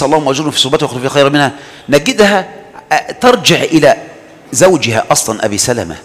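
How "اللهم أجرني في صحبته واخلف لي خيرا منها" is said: quick quoted prayer. Retold or imitated. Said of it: "وهي تذكر حديث".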